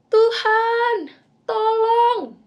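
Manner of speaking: high, loud panicked call; desperate cry for help. Acted. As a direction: shout for rescue while sinking.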